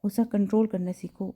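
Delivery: usual speaking voice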